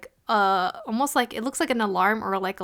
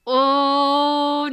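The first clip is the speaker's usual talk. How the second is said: a sudden higher, louder gasp of dismay; 4 semitones higher, 5 dB louder than usual. Retold or imitated. Retelling her own shock while watching, a mock dismayed oh. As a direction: react like the news just hit. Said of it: "And I'm like".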